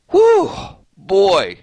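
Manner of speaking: fired-up exclamation. Real, not acted. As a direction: let off steam with a big exhale of hype. Venting, fired up on stream.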